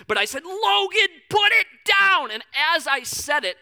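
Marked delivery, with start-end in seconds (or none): 0.4-2.3 sharp loud shout, pitch jumping high; angry yell at daughter. Retold or imitated. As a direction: yell the command at a toddler reaching for the can. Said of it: "and I, I, I yell".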